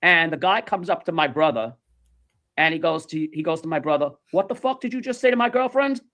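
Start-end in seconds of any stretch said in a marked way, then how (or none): 4.3-6.0 higher-pitched mock confrontation; imitated indignation, not shouted; retold angry challenge. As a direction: challenge him like an offended boyfriend. Boyfriend confronts the brother.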